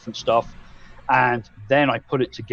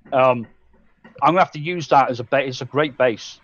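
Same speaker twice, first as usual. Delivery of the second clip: ordinary delivery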